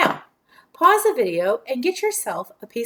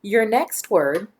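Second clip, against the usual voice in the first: usual speaking voice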